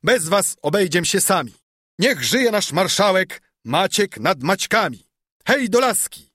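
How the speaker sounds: loud high rallying cry; loud cheering call, rising to the end; shout. Acted. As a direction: rouse the crowd. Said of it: "Kropiciel krzyczał".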